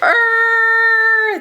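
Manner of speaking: high, long creature groan; monster groan. Acted. Imitating the monster waking.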